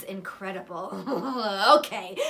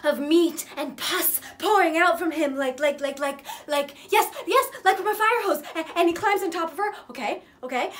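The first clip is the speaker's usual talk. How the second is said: loud, high, gleeful rush; frenzied horror retelling; 5 semitones higher, 7 dB louder than usual. Acted. Retelling a film's bloody climax, loud and frantic. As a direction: get carried away by the gore.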